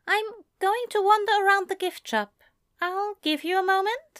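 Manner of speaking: high, fast, fussy angel voice; Aziraphale voice. Acted.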